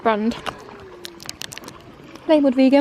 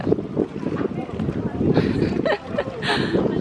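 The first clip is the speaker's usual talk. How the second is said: long, high-pitched giggle; real squealing giggle; high laugh; 13 semitones higher than usual. Real, not acted. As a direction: burst into a high, delighted laugh. Delighted laugh at lunch spot.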